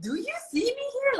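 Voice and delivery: high-pitched, quick, indignant challenge; indignant retort. Retold or imitated. Wife confronting a woman staring at her husband, indignant. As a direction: rise sharply, demanding to be noticed.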